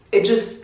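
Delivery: sharp gasp for air, pitch up; demo gasp. Shown as a demonstration. Explaining breathlessness to viewers, a sharp gasp. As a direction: show how breath catches in the throat. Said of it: "it's like you go like".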